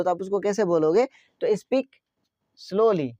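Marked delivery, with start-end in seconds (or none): 1.5-3.1 "Speak" lifted, pause, "slowly" falling; word by word. Shown as a demonstration. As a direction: dictate "Speak slowly" one word at a time. Teacher models phrase word by word. ("Speak slowly" शब्दों के बीच रुककर)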